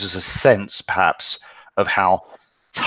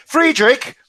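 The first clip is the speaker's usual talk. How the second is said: a loud high call through the door; 7 dB louder than usual. Acted. Boy at the door calling his friend, loud. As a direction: call his name up the stairs. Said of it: "I called".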